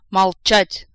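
very loud officer's shout; barked command. A narrator's reading. Colonel silencing two swearing partners, barking. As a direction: bellow for silence. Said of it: "снова рявкнул полковник".

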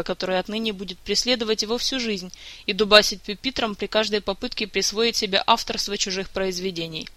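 ordinary delivery

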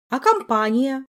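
loud, high indignant retort. Acted. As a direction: protest with outrage. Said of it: "возмутилась Ольга".

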